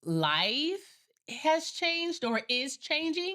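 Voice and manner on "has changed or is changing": slow, high stretched words; drawn out. Real, not acted. Drawn-out reflective phrase.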